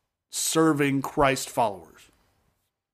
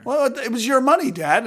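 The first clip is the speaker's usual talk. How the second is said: put-on indignant older son; 8 semitones higher than usual. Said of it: "But the other son goes".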